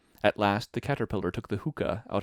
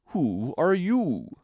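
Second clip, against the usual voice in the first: very slow, raised, scornful drawl; contemptuous; 7 semitones higher, 5 dB louder, much slower than usual. A narrator's reading. Caterpillar dismissing Alice's opinion, contemptuous. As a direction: stretch it out high and loud with disdain. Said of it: "said the caterpillar contemptuously".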